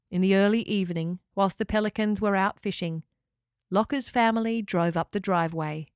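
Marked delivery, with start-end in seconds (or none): none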